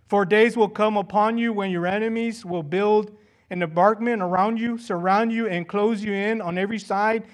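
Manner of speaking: loud, driving proclamation of judgment; forceful scripture reading. Acted. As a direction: thunder out the coming siege. Reads the prophecy forcefully. (proclamación enérgica y en voz alta)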